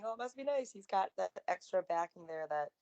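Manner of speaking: ordinary talk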